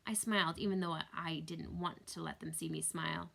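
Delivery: plain reading voice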